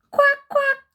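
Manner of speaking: high, loud quacking; duck quacks. Acted.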